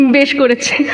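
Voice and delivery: ordinary talk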